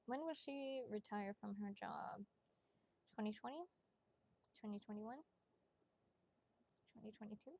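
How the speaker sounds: soft, musing voice fading to very faint; quiet questioning. Real, not acted. Asking the cards under breath.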